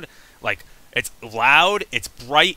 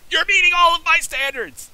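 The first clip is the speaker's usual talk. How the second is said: much higher, loud cartoon skeleton voice; giddy high-pitched skeleton praise; Papyrus impression; 4 dB louder than usual. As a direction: belt it like an overjoyed goofy skeleton. Quoting Papyrus's line from the game.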